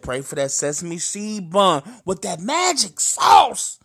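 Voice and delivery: mock preacher. The cousin makes him pray for a burger, slow shouted mock preaching. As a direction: preach about a burger like scripture. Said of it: "He said".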